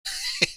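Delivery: short high-pitched laugh; a quick, high burst of laughter; real little high chuckle. Real, not acted. Amused while chatting on stream.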